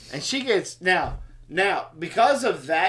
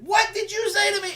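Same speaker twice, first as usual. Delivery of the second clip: offended retort; faster than usual. Retold or imitated. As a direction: bark it back, insulted. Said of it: "I got offended".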